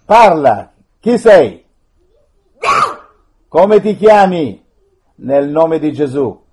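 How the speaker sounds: loud, stern command; commanding exorcist. Real, not acted. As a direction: demand the spirit's name. Priest commanding a spirit.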